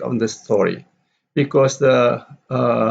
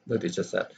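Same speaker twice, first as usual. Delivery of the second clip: "the teacher said" low and much softer; unstressed tag; 5 semitones lower, 11 dB quieter, faster than usual. Shown as a demonstration. Teacher reading the reporting clause, unstressed. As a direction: drop "the teacher said" right away. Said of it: "So the teacher said is no".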